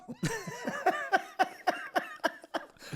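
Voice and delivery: long rising laugh; high cackling laugh. Real, not acted. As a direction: break up laughing. Laugh after joke.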